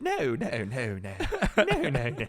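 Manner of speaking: hammed-up British "no", breaking into laughter; exaggerated UK accent through laughs; mock UK accent. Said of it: "imitate somebody with a U K accent".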